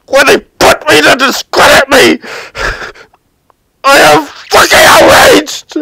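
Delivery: a real outburst, voice cracking with crying; furious crying shout. Real, not acted. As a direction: rage breaking into sobs at the cops. Furious rant about police.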